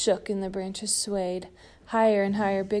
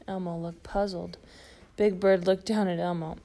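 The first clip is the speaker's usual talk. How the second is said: usual speaking voice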